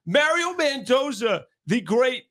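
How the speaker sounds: usual speaking voice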